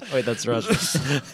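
slow words through real laughter; laughing. Real, not acted. Cracking up over the accent joke, laughing. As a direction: get it out between laughs.

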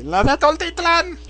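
high, slow showgirl voice; stripper voice. Acted. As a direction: purr it like a showgirl. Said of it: "voz de puta". (voz de teibolera)

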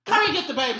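high, loud demand. Real, not acted. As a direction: shout the demand. Heated call to fathers.

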